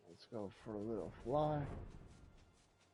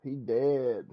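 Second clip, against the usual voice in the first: loud reaction; 3 semitones higher, 14 dB louder than usual. Real, not acted. Reacting to cutscene death.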